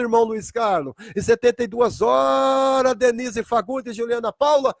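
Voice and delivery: high, insistent sermon refrain; full-force preaching. Real, not acted. Preacher to named congregants, fervent full-force preaching. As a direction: drive the refrain home, stretching the last round.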